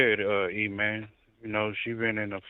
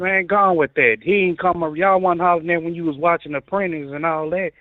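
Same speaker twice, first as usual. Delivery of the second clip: heated; 6 semitones higher, 7 dB louder than usual. Real, not acted. Heated argument, talking over.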